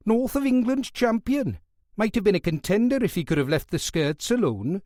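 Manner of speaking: can't hide his boxing enthusiasm; enthusiastic Welsh fan. Acted. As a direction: Welsh guard gushing to the nurse about the boxer. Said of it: "unable to keep his enthusiasm from his voice".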